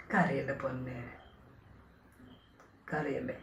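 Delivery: hushed, tender whisper saying don't cry; soothing whisper. Real, not acted. Soothing his crying girl over the phone in a whisper. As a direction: whisper softly to calm her tears.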